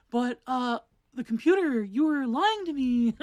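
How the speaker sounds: mock her character voice, drawing it out; teasing a friend with her video voice; mocking friend's voice. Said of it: "when I mock her like that".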